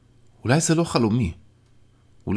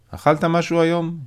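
higher friend's voice, casual question; a friend checking on you, raised pitch; Yaron asking; 10 semitones higher, 3 dB louder than usual. Acted. Friend's voice in story.

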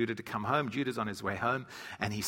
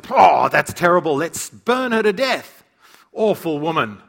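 mock outraged shout; 3 semitones higher, 9 dB louder than usual. Judah, hearing Tamar is pregnant, orders her burned: hammed-up furious shout. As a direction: bellow it like a self-righteous patriarch.

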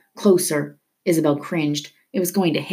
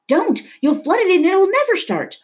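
high, urgent outburst; urgent protest; 4 dB louder than usual. A narrator's reading. Luis stopping him yanking the starter chain, urgent protest. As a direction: object in alarm, pitched very high. Acted protest over the engine.

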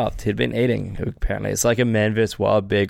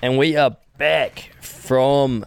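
announcer voice; 3 semitones higher, much slower than usual. Pretend. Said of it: "do the announcer voice".

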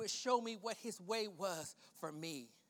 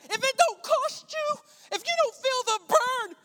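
high, loud pulpit challenge; fiery, raised-voice exhortation; preacher's shout; 13 dB louder than usual. Real, not acted. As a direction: belt it out to the congregation. Challenges congregation at full force.

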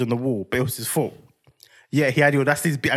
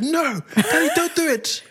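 high, mock-desperate little boy; put-on childish pleading; imitated pleading child; 11 semitones higher, slower than usual. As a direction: beg Daddy like a child about to lose his hair. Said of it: "So I kind of threatened".

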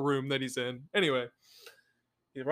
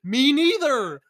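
mock rich guest; 11 semitones higher, 5 dB louder than usual.

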